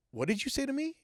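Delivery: high-pitched, disbelieving retold question; incredulous question. Man asks bartender who spoke in his ear, high incredulous voice. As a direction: ask it sharply upward, thrown off.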